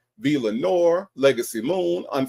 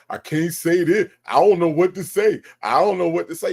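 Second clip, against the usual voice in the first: slightly raised, whiny mimicry; mock whining; 3 semitones higher, 3 dB louder than usual. Fed-up host mimicking Dre's complaints, mock whining. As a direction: imitate him whining. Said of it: "I just get tired of him whining about".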